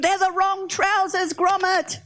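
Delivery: high-pitched mock yell, no real volume; panicked cartoon yell. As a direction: cry it out high, stretching the words. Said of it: "and he's yelling".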